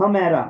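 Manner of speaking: हमेरा with a strong h onset; with h sound. Shown as a demonstration. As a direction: stress the h of हमेरा for the class. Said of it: "हे की sound के साथ".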